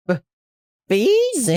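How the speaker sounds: high, squeaky disbelief; very high, startled echo; startled question. Acted. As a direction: squeak it out, taken aback. Shopkeeper startled by demand.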